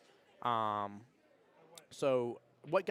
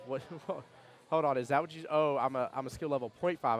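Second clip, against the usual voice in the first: louder, a sudden penny-drop; surprised realization; 4 semitones higher, 7 dB louder than usual. Real, not acted. Streamer spotting a viewer's sly joke, surprised and amused. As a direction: catch the joke mid-sentence, half laughing.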